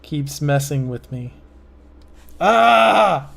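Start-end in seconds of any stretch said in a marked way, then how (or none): 2.4-3.3 loud, higher-pitched cry of frustration; frustrated yell. Real, not acted. Artist messing up a line, frustrated yell. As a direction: let out a loud exasperated "ah". Frustrated at failing to stop laughing.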